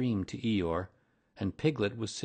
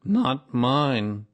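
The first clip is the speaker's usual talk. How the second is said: loud, higher, proud interruption; lightly voiced proud donkey; proud Eeyore; 4 semitones higher, 10 dB louder than usual. A narrator's reading. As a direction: cut in with pride. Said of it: "said Eeyore proudly".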